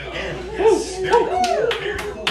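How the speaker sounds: smug mock pride. Mock bragging about a kiss.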